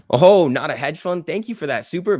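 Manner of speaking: light up at the donation; excited thanks. Real, not acted. Streamer thanking a donor for a super, loud and excited.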